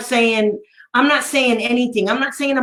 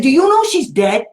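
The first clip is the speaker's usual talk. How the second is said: a pointed, loaded question, no louder than telling the story; 4 semitones higher, 5 dB louder than usual. Retold or imitated. Advocate needles the special ed director at the hearing, pointed. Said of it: "I said it just like that".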